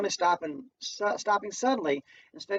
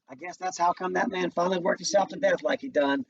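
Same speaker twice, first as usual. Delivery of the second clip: ordinary delivery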